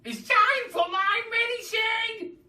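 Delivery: put-on high, loud, slow old-woman demand; Grandma screeching for her medicine. A narrator's reading. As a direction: bark the order like a cranky granny. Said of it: "she screeched".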